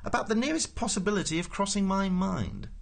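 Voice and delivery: high-pitched pompous official; indignant protest. A narrator's reading. Prosser answers Ford while settling back, high indignant protest.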